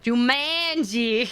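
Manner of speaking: Jumanji stretched slow on a flat MAN; exaggerated pronunciation. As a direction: say Jumanji as joo-MAAAN-jee, drawn out.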